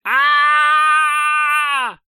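piercing, very high and loud scream; full-throated scream. Acted. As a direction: scream at the top of your lungs. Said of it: "орёт во всё горло".

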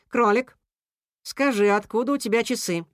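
composed, falling question; calming question. A narrator's reading. Cat questioning the rabbit amid uproar, calming. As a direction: ask evenly, seeking the facts. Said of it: "попытался успокоить зверей Кис-Кис".

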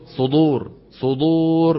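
"صدور" with a thick emphatic dal; heavy dal. Shown as a demonstration. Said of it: "بيطلع دال إيه مفخمة". (دال مفخمة خطأ)

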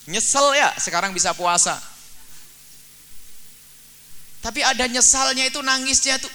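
high, loud sermon climax; fiery preaching. Acted. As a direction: preach it hard to the congregation. Preaching at full force.

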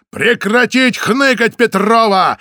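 slow, high, loud barked order. A narrator's reading. Samoylov barks at Petrova, slow loud order. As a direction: bark each word at her. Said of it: "рявкнул Самойлов".

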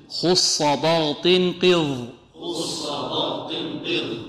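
slow syllable-by-syllable drill chant; slow call-and-response drill of خص ضاط قض; drilled recitation. Shown as a demonstration. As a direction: drill the letters slowly with the class. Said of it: "انطقوا معي".

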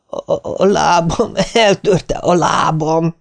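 high, slow wail of agony; pained wail. A narrator's reading. Martin collapses clutching his kicked thigh, wailing in pain. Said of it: "fájdalmas sikójjal csuklott össze".

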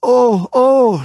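high, loud broken cries; halting, climbing wails; stammered confession. A narrator's reading. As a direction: gasp out each cry in rising ecstasy. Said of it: "stammelte Joanna ihr Bekenntnis".

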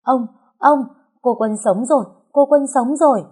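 high, loud overjoyed yelling; joyful shout. A narrator's reading. Servant shouting to master that the girl lives, overjoyed yell. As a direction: burst out with the good news. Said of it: "tiếng hét đầy mừng rỡ của gia nhân".

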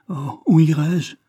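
a lost woman crying out; desperate cry. Acted. Héroïne se lamente seule. (cri désespéré, plus aigu, plus fort et plus lent)

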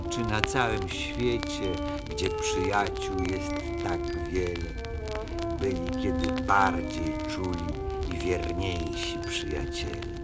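ordinary delivery, nothing marked

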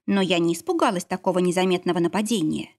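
plain reading voice

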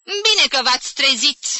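loud, high, stung remark; piqued. A narrator's reading. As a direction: say it with a sarcastic sting. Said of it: "zise ea înțepat".

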